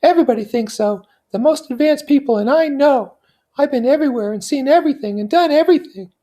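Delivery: high voice, sure of herself; convinced. Acted. Daisy assuring Nick she knows everything, high and convinced. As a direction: press each claim as settled fact. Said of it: "she went on in a convinced way".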